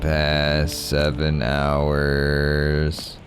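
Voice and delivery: very slow, stretched-out complaint; drawn-out lament. Real, not acted. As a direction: drag every word out, mock-tragic. Mock lament about adult sleep.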